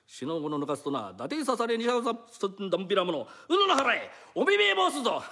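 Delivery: kabuki threat. Acted. Thief threatens the mistress in mock kabuki style. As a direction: strike a kabuki pose with your voice. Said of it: "ようよ、音羽屋。".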